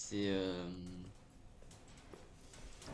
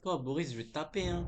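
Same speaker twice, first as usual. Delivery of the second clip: mock threat; 5 semitones higher, 6 dB louder than usual. Mock threat to game character.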